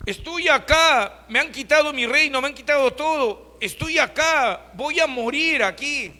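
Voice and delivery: a fallen king wailing in his cell; desperate lament. Acted. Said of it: "Y comenzó a llorar".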